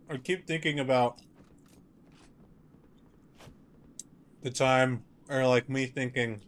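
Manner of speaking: talking around a mouthful of ice; words slurred by ice in the mouth. Real, not acted. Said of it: "sorry, there's ice in my mouth".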